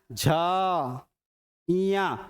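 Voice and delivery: झ and इया held long; drawn-out, sustained झ and इया; resonant voiced sounds. Shown as a demonstration. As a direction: let झ ring on, then इया. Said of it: "गूंज रहा है".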